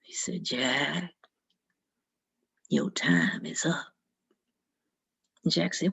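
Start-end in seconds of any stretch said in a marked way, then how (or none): 0.4-3.9 very low, slow, menacing whisper; devil's whisper. Acted. The devil tells Jack in the pub his time is up, in a low whisper. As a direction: breathe it like the devil come to collect.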